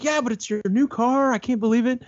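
high-pitched exasperated protest; frustrated outburst. Retold or imitated. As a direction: re-enact a worked-up car owner complaining. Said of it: "I'm not getting frustrated".

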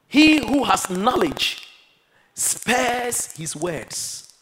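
drawn-out verse reading; solemn scripture reading. Acted. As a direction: read each word like a verdict.

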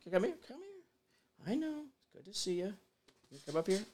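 high, soft coo to a dog; pet talk. Real, not acted. Greeting his dog Daisy who wandered in, high soft coo.

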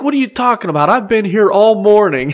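high, whiny indignant voice; hammed-up whiny protest; mock indignant guy. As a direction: play the clueless guy protesting. Bud mimics the intruding hunter.

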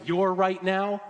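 loud, high, driving exhortation; full-force preaching. Acted. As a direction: build the close and push hope at them.

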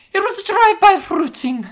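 very high loud falsetto; falsetto impression. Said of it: "recreate that line".

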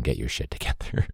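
breathy mock-stern whisper to the kids; half-whispered warning. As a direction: hiss it like a joking threat.

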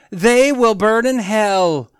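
lightly hammed-up preacher damning them; mock preacher shout. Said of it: "Mister Dunn shouted at them".

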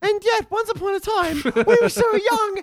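very high squeaky put-on voice; hammed-up squeaky youngster, innocently reminiscing; mock younger self. Parody of their younger, higher-voiced selves.

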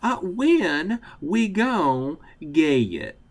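slow, drawn-out Southern twang; Southern accent. Shown as a demonstration. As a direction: stretch it out, Southern style. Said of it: "you're gonna have to be the one who says".

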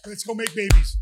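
fiancé cheering after the fight, loud. Retold or imitated. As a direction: call it out in triumph. Triumphant call after the fight.

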